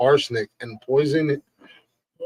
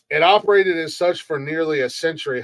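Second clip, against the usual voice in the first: ordinary talk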